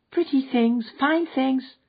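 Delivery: put-on high, bright childlike cry over treasures; enthusiastic little girl. A narrator's reading. Little girl showing the man her glittering mica, excitedly. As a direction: show off the shiny things with glee. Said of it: "cried the little girl enthusiastically".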